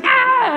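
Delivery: put-on high delighted baby squeal. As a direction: squeal like a happy infant. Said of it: "And she's like".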